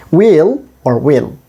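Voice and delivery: "Will or will", slowly, first with a long ee, then with a short i; long then short vowel. Shown as a demonstration. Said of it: "both are correct".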